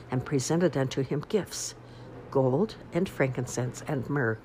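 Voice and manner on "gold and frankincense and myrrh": ordinary delivery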